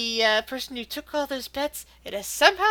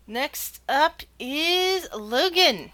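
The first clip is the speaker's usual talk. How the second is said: very slow, higher suspenseful announcer; drawn-out announcement; 4 semitones higher, much slower than usual. Acted. Game master announcing next contestant, slow drawn-out reveal. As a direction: stretch each word before revealing the name.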